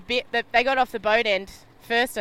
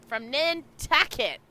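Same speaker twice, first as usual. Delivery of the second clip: slow, drawn-out Australian "Nantucket"; Australian accent; 2 semitones higher, 4 dB quieter, slower than usual. Shown as a demonstration. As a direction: say Nantucket in Australian, stretched out slowly. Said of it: "saying Nantucket in an Australian accent".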